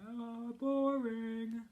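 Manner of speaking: put-on slow, drawn-out bored kid voice; bored drawl. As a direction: stretch it out, utterly bored. Picture caption read in Bean's voice.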